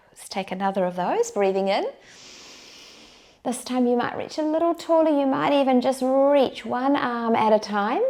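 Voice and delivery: calm them into the breath; guided meditation. Acted.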